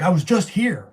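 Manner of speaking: high, loud, exasperated retort; indignant outburst. Retold or imitated. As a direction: blurt it out in disbelief. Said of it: "I was like".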